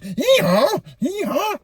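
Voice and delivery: a donkey wailing under the stick; pained comic donkey braying. Acted. Donkey being beaten.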